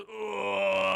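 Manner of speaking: long, drawn-out imitated groan; monstrous groan. Disfigured Matt answering his body double, monstrous groan. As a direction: groan as a disfigured blob of clay.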